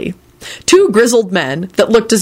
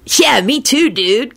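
higher-pitched, cheerful dude voice; happy; 5 semitones higher, slower than usual. A narrator's reading. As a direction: chime in happily. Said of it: "Kenny announced happily".

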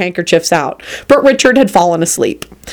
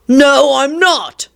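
higher, drawn-out contradiction; escalated stubborn refusal; 8 semitones higher, much slower than usual. Acted. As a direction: dig in, slower and higher. Escalated refusal in sibling quarrel.

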